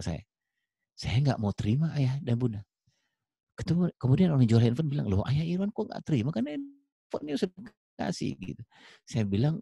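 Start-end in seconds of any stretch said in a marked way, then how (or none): 5.1-8.4 higher-pitched mild protest from a baffled seller; puzzled seller. Acted. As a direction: act mildly baffled, pitch lifted. Acted puzzled phone seller. (penjual bingung, nada tinggi)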